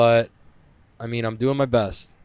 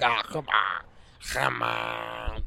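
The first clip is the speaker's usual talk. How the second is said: softer, whiny mock protest; mock MMA guy; 6 dB quieter, much slower than usual. Imitating MMA people complaining, lightly voiced whine. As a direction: grumble like a put-out fighter. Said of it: "the M M A people who would be like".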